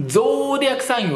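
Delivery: ゾーディアックサイン with a long, rounded British "oh" in ゾー; British pronunciation. Shown as a demonstration. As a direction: draw out a round "oh" at the start of ゾーディアックサイン. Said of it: "イギリス発音だと". (ゾーを丸く伸ばすイギリス発音で「ゾーディアックサイン」)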